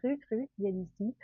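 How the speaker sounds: a hint of a French man's accent; imitated French accent. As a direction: imitate an eager French colleague. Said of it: "there was two French guys".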